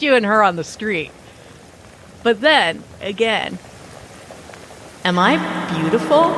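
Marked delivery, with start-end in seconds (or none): 5.0-6.4 rising, lightly eerie ghost question; eerie ghost woman. Acted. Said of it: "Or maybe more like".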